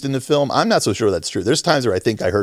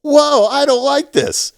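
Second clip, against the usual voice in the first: high, drawn-out mock protest; slow, high caricature of a complainer; slower than usual. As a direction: stretch out a high-pitched objection. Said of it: "And then [name] starts to go".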